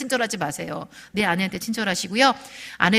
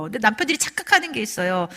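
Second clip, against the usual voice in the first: ordinary talk, nothing marked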